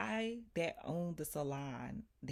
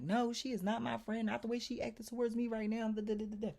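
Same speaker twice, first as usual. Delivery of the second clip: raised, higher-pitched indignant denial; retold angry protest; 8 semitones higher, 4 dB louder than usual. Retelling her answer to the landlord about her friend, indignant. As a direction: push back hotly, in a retelling.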